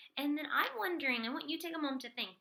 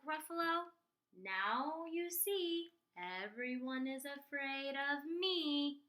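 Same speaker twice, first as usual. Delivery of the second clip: higher, slow, drawn-out voice; smug little storybook mouse; boastful mouse; 4 semitones higher, slower than usual. Acted. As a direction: gloat with stretched vowels.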